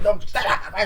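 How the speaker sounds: high, loud mock warning; acted panicked yell. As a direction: imitate yelling someone away. Acted yelling in fight.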